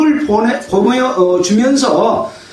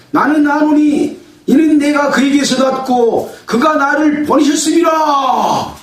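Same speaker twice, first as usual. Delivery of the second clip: crying out; 3 semitones higher than usual. Acted. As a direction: proclaim it in a big raised voice, drawing out the phrases. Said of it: "크게 외쳐서 말한 거죠".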